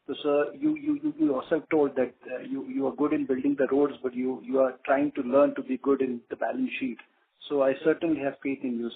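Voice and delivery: ordinary talk, nothing marked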